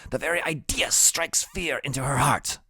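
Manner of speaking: put-on very high, grandiose kid voice; high-voiced little schemer; brainy, secretive Freak. A narrator's reading. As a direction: pitch it way up, conspiratorial.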